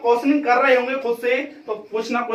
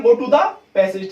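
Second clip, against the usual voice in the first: each word spaced out, very slow; slow emphatic step; 4 dB louder, much slower than usual. Shown as a demonstration. Teacher to students, slow spaced-out step. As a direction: dictate it. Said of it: "आपको क्या करना है".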